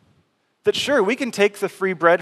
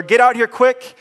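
a real shout to the partners on the shore, higher and louder; yell; 7 semitones higher, 5 dB louder than usual. Retold or imitated. Peter yelling to his partners on shore, loud urgent call. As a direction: call out across the water for help. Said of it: "Peter has to yell to his business partners".

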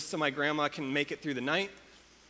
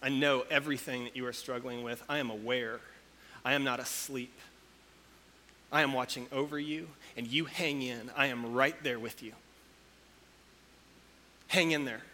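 God softly reassuring Israel, lower and softer; 4 semitones lower, 4 dB quieter than usual. Retold or imitated. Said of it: "the God who made heaven and earth says".

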